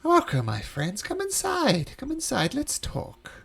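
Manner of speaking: very high, lilting host; coaxing high-pitched stranger; welcoming unseen voice. Acted. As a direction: beckon them in, swooping high on each invitation. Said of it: "you hear a voice and says".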